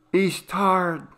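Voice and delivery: smooth over his rudeness; excusing mother. Acted.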